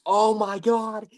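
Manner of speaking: high, drawn-out "oh my God" cry; imitated scream. Fan retelling watching the fight with mom, imitated scream. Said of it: "We were screaming".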